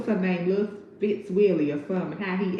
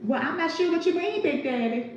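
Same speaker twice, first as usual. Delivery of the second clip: high coy voice; mock-innocent reply in a high voice; character voice; 9 semitones higher than usual. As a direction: play innocent in a high put-on voice. Voicing a character.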